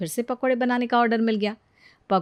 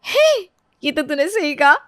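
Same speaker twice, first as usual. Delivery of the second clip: surprised agreement; 6 semitones higher, 5 dB louder than usual. Acted. Priya struck by friend's idea.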